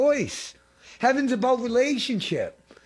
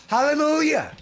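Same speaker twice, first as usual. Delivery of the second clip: joyful hallelujah; 7 semitones higher, 3 dB louder than usual. Real, not acted. Preacher exclaims after testimony.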